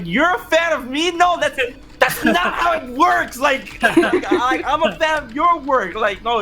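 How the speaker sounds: high-pitched, laughing protest; flustered disbelief. Retold or imitated. Fan telling an admired actress he is her fan, flustered. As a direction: act out incredulous fanboy protest.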